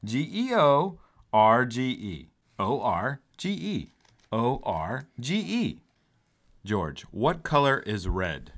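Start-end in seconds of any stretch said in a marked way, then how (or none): 0.0-5.8 spelled out slowly, letter by letter; slow spelling. Shown as a demonstration. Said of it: "teaches spelling slowly".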